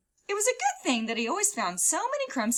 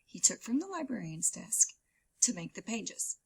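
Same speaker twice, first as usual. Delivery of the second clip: usual speaking voice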